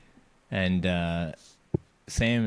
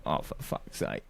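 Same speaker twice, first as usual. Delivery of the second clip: whispered under the breath, much softer; exasperated whisper; 7 semitones lower, 6 dB quieter than usual. Retold or imitated. Retelling his fed-up aside about the clingy stranger, whispered. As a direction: mutter it so the stranger can't hear.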